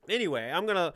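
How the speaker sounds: ordinary talk, nothing marked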